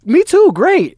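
a pasted-on cheerful reply to sad news; oblivious chirpy reply. Retold or imitated. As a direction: answer cheerfully without hearing a word. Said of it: "mock oblivious reply".

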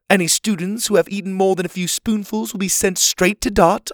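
lightly voiced genteel leader; camp leader Ma. A narrator's reading. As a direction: address the campers like a kindly matron. Ma's announcements.

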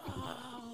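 faint, breathy far-off scream; distant scream. Retold or imitated. Brother's screams heard from the TV room, faint distant scream. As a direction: scream imitated very softly, as from far away. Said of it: "like, far away".

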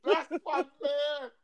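high, slow hyped call; excited. Real, not acted. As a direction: drag it out in excitement. Hyped reaction to lyrics.

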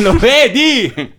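high, slow, drawn-out gotcha; triumphant gotcha. Real, not acted. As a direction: crow it with a laugh. Catching a friend out.

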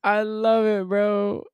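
high, loud delighted cheer; excited. Real, not acted. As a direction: shout your joy at the bit. Thrilled by friend's Mikey impression.

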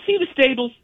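high mock guide voice asking; imitating Sally. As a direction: point it out brightly, way up high. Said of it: "she goes".

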